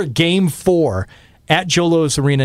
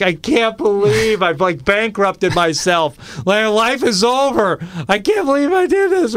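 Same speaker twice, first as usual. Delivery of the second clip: slow wail rising ever higher; retold panicked disbelief; 7 semitones higher, slower than usual. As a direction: act out despair, climbing to a high-pitched cry. Retold panic at being broke.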